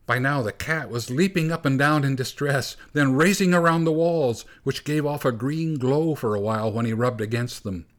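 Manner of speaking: ordinary delivery, nothing marked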